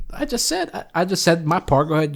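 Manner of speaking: ordinary talk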